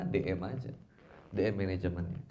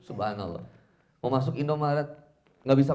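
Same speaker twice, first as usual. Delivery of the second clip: normal talking voice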